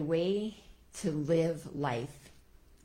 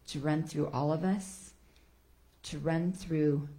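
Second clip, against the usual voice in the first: prayer reading. Acted. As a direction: read it as a gentle blessing. Reads a Kabbalah prayer.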